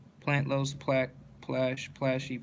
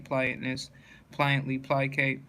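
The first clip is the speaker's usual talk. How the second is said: ordinary talk, nothing marked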